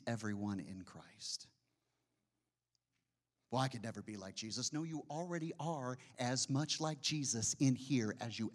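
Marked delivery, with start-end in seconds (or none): 3.5-4.7 quick, softer mock doubter; mock objection. Preacher imitates a doubting believer, quickly. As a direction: toss off a doubter's excuse. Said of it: "objection voiced".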